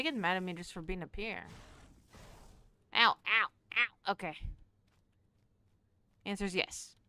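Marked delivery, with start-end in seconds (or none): 2.9-4.1 mock pain yelps. Character getting attacked in game.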